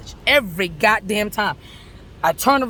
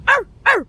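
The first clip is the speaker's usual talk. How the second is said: high loud mock pirate arr; playful pirate arr; pirate growl; 13 semitones higher, 6 dB louder than usual. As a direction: bark it like a cartoon pirate.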